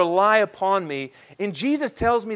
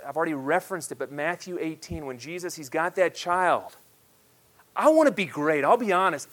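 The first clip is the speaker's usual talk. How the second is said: ordinary delivery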